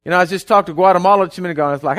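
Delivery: ordinary talk, nothing marked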